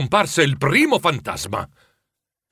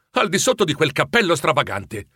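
usual speaking voice

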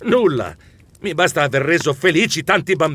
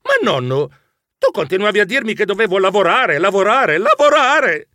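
fast, rising, louder squeaky protest; squeaking protest; 5 semitones higher, 3 dB louder than usual. Acted. Mouse protesting to grandfather, building high and loud. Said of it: "Io squittii".

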